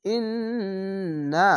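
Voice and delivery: ইন্না with long humming nn held; nasal ghunna. Shown as a demonstration. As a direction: draw out the nn in ইন্না through the nose. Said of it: "নুন জাবার না". (ইন্না গুন্না দিয়ে টেনে)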